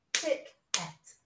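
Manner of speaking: "Tick, et" as two beats with a gap; split syllables. Shown as a demonstration. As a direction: break it cleanly into "Tick" and "et". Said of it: "Let's split the syllables up".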